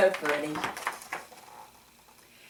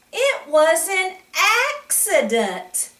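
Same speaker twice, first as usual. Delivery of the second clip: put-on child's excuse; 9 semitones higher, 4 dB louder than usual. David's excuse voiced in story.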